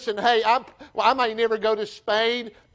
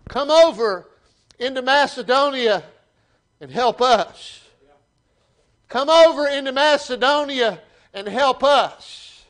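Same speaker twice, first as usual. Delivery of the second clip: pleading call; 5 semitones higher, 5 dB louder than usual. Acted. Preacher voices Macedonian's plea.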